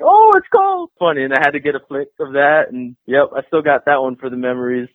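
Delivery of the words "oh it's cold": high, loud mock yelp at cold; shocked yelp. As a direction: squeal as the cold hits you. Mimics fan's yelp at paint.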